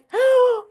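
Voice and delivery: sharp, high gasp of happy shock; a loud gasp at the good news; delighted gasp. Real, not acted. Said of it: "I'm trying to contain myself".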